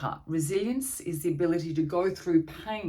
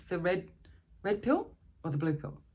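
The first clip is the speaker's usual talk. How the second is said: ordinary talk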